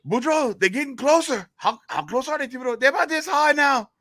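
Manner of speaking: two high, excited Cajun buddies; hammed-up Cajun alarm; Cajun joke character. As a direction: call back and forth in a high comic voice. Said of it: "get into this character here".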